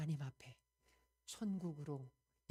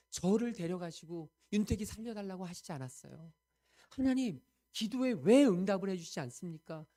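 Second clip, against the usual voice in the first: rising to a loud, high, aggrieved cry; indignant protest; 5 semitones higher, 9 dB louder than usual. Retold or imitated. Preacher voicing her bitter complaint to God, loud indignant cry. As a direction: protest to God as if wronged, building up. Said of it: "너무너무 억울해서 하나님 앞에 막 따지고".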